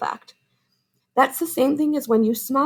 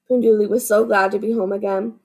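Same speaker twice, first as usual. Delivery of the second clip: ordinary delivery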